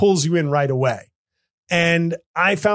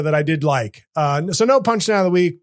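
normal talking voice

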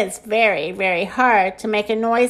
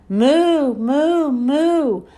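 rising and falling moos; higher lilting cow imitation; 4 semitones higher, much slower than usual. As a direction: moo like a cow, drawn out. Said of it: "let's review".

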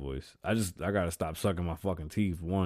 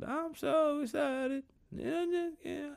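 very high, slow falsetto excitement; squeaky mock excitement; slower than usual. Opening the next show, squeaky falsetto mock excitement. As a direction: squeal it like a cartoon. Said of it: "start the next show with".

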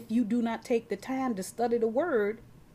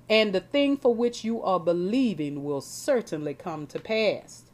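usual speaking voice

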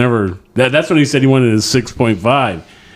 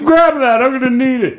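high, mock-urgent plea; a hammed-up high cry to save the toe; mock frantic; 13 semitones higher than usual. Still joking about the flying toe, a high hammed-up plea.

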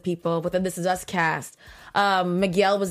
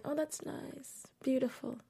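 unmoved reaction; 4 semitones higher, 14 dB quieter than usual. Retold or imitated. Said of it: "I was just like".

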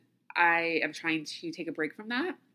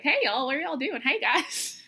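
bubbly Southern greeting; 8 semitones higher, 3 dB louder than usual. Retold or imitated. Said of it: "And I'm just like".

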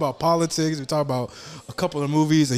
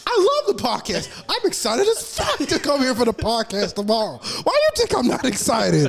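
mock whiny protest; 8 semitones higher than usual. S0 mocks friend's protest.

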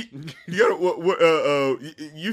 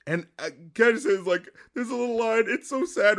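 usual speaking voice

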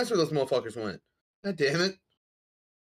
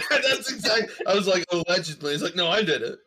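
loud, high retelling through laughter; laughing; 6 semitones higher, 5 dB louder than usual. Real, not acted. Co-host retelling a friend's theft confession, laughing.

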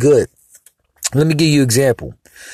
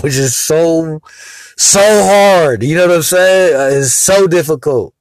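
exasperated outburst; 5 semitones higher, 4 dB louder than usual. Real, not acted.

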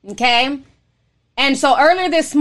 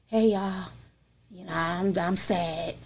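mopey, much quieter and lower; mock sad; 6 semitones lower, 11 dB quieter, slower than usual. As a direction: droop like a gloomy streamer nobody wants to watch. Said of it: "I'm sitting here like".

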